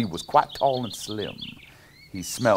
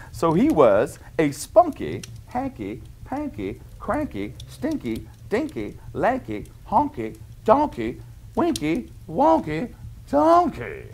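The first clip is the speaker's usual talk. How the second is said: higher, sing-song list of rhymes; rhyming refrain; 7 semitones higher, slower than usual. Acted. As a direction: bounce through the silly rhymes for kids. Rhyming refrain read to children.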